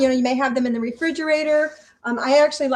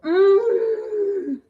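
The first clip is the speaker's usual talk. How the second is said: delighted mm; 8 semitones higher than usual. Real, not acted. Tasting her sweet treat.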